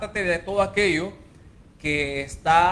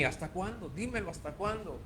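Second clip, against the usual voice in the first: hushed plea; 10 dB quieter than usual. Acted. God pleading with the sinner.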